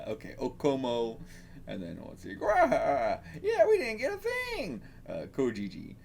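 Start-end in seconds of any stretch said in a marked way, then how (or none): 2.4-4.8 comic growl into very high voice; growling beggar character. Acted. Beggar complaining they got nothing, high put-on voice. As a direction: open on a growl, then squeal it.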